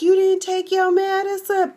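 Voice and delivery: high-pitched mock nurse reprimand; scolding nurse. Nurse accusing old patient.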